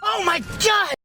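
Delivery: high, drawn-out cries rising to a yelp; mock startled cries. Pranking at the drive-thru, mock startled cries. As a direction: act terrified of the horn. Fake scare.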